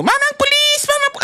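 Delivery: very high, panicked cry to a policeman; frantic call. Retold or imitated. Victim crying out to a policeman, very high and frantic. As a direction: shriek for the cop, cut off mid-word. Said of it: "Sasabihin mo".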